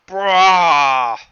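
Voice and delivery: loud, drawn-out monster roar; high, open-throated yell; mock death cry. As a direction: bellow like a felled beast. Game master voices the dying monster.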